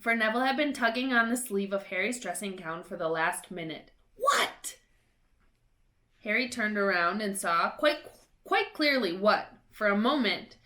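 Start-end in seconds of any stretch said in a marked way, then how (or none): 4.2-4.8 much higher, sharp; startled. A narrator's reading. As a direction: whip around annoyed. Neville tugging sleeve.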